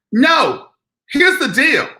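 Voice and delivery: loud, high and emphatic; indignant outburst. Real, not acted. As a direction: insist it hard.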